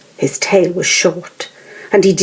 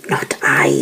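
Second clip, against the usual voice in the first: hissy snake. Acted. Snake answers.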